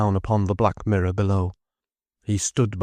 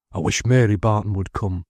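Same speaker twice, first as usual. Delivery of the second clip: ordinary delivery, nothing marked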